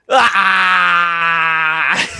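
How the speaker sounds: loud, high, drawn-out mock wail. Viewer at the film's credits, mock bawling. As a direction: wail out loud as if overwhelmed by the ending. Mimics crying at the end credits.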